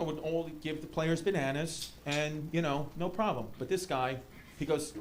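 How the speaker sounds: ordinary delivery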